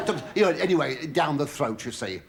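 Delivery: usual speaking voice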